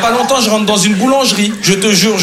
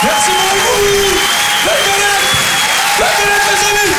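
mock grand thanks; 12 semitones higher than usual. Comedian closing his set with a high, hammed-up proclamation. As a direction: stretch it out like a pompous showman.

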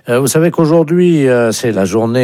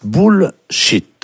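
bullshit, higher and split into two syllables; dictated English lesson; 5 semitones higher than usual. Shown as a demonstration. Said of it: "la première phrase pour aujourd'hui qu'il va vous falloir apprendre". (dictée d'anglais, syllabe par syllabe)

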